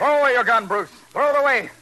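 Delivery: shouted command to drop the gun. Acted. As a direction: yell the order across the roof. Detective orders the gunman.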